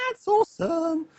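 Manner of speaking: very high-pitched mock cheer; exaggerated delight. Streamer cheering viewers who disagree, exaggerated high delight. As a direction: squeal it in playful delight.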